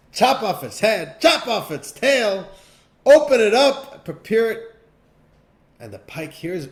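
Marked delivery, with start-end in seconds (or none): 0.1-4.7 loud, high commands, slow at first; king giving orders. Acted. King ordering the cook to gut the pike, loud and high. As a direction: bellow the orders down the kitchen. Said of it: "the king puts up a beautiful big smile and says".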